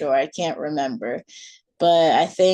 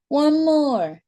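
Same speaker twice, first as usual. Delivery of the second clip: put-on begging child; 10 semitones higher, 4 dB louder than usual. A narrator's reading. Child begs for another song.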